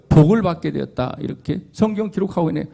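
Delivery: ordinary talk, nothing marked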